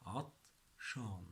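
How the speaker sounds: عطشان as "at", pause, "shaan"; syllable-by-syllable demo. Shown as a demonstration. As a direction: sound out عطشان in two separate pieces. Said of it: "This is for Egyptian".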